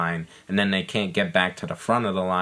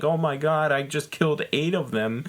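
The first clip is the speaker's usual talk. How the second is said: mock shock; 7 semitones higher than usual. Max's shock after killing flies.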